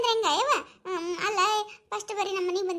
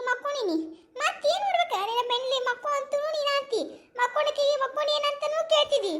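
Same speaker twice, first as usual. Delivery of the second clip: high-pitched, cranky complaint; irritated scolding; 7 semitones higher than usual. Acted. Woman lying down scolds whoever asked if she sleeps, irritated. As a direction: scold crossly in a raised pitch.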